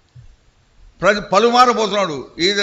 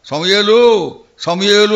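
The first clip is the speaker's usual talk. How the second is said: louder, weighed-down calling of the name; heavy-hearted call; 3 semitones higher, 8 dB louder, slower than usual. Acted. God calling Samuel with a burdened, louder voice. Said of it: "ఎంతో భారంగా".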